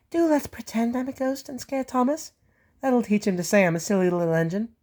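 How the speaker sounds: high, cheeky little engine, plotting; a mischievous small engine hatching a prank; Percy's voice. Acted. Percy planning to scare Thomas, high and cheeky.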